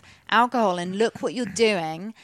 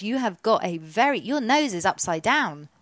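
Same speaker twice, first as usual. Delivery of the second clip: ordinary talk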